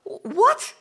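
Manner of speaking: a sudden high, loud "What?"; mock disbelief. Listener startled by Jesus forgiving sins, high incredulous yelp. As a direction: yelp it out like someone caught off guard.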